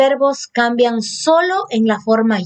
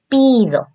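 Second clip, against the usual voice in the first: "pido" repeated higher for emphasis; emphatic repeat; 4 semitones higher than usual. Shown as a demonstration. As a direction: stress "pido" clearly once more. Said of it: "digo yo pido".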